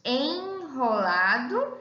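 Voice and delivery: "enrolado" slowly, with en held long; slow, carefully divided "enrolado"; stretched syllables. Shown as a demonstration. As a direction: draw out the first syllable of "enrolado". Example word stretched to show syllables.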